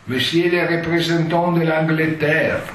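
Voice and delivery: booming, high usher's announcement; stentorian usher. Retold or imitated. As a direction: announce it like a court usher in a great hall. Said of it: "con voce stentorea andava annunciando". (voce stentorea da usciere)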